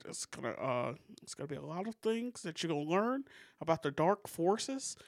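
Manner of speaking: persuasive presidential impression, halting with rising phrases; Barack Obama. President persuading Dennis about dark forces, playful impression. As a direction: imitate a president making a sales pitch. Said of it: "That was a pretty good Obama impression".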